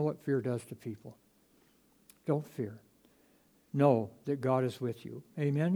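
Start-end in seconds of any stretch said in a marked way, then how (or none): none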